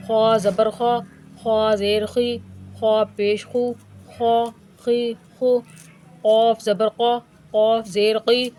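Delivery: full, heavy-mouthed syllable drill; heavy letters drill. Shown as a demonstration. (حروف کو موٹا پڑھنے کا انداز)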